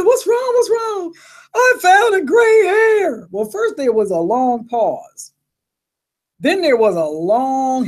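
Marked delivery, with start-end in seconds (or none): 0.0-1.1 a hint of a high, anxious mother; lightly voiced mom asking twice; worried mom. Mother on the phone hears her daughter crying, lightly worried voice. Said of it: "And she said". 1.5-3.3 high, slow, tearful wail; crying like a baby. Retold or imitated. Daughter phones her mom about the gray hair, high tearful wail. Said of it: "I'm just crying like a baby".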